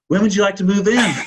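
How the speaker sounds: high and loud, breaking into laughter; mock-innocent question, laughing through it; laughing punchline. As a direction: deliver the punchline while cracking up. Punchline quote to the couple.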